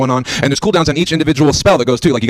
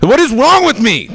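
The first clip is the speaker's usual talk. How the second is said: exasperated; 11 semitones higher, 3 dB louder than usual. Real, not acted. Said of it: "self-mocking exclamation".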